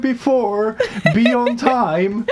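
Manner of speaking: high, laughing scold. Real, not acted. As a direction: tease them through laughter. Teasing late viewer, laughing.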